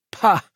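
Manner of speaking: high, loud scoffing pah; scornful pah. Acted. Boy scoffing at a friend's complaint, scornful pah. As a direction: blow it out in contempt.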